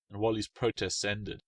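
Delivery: ordinary delivery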